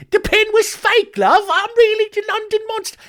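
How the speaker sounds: shrieking high, loud mock Cockney; awful high-pitched Cockney. The London Monster confesses the pin was fake, squeaky mock Cockney. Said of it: "high-pitched Cockney accent".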